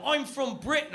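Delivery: put-on high-pitched caricature of a British accent; mock British accent. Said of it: "I hear a British accent".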